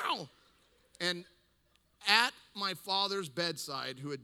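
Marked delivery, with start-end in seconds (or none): 0.1-0.3 startled cat screech; cat-like yowl. Retold or imitated. Son startled at father's bedside, cat-like yowl. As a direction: mimic a spooked cat's yowl. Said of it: "like a cat".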